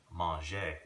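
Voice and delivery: "manger" as "mon-zhay", no r; silent French R. Shown as a demonstration. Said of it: "they don't pronounce the R".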